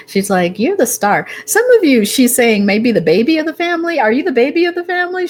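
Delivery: high, loud, thrilled outburst; fast, delighted squeal at the reading; excited. Real, not acted. Thrilled by the star card.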